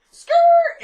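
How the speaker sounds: high, loud screeched "skrrt"; imitated tire screech. Alex ghosting out of there in her car, tire screech. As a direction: peel out with a piercing vocal skid. Imitates tires as she speeds off.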